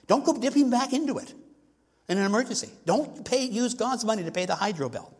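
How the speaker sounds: high, pressing plea from the pulpit; fiery exhortation. Retold or imitated. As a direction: urge them not to touch God's money. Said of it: "I always say this to my people".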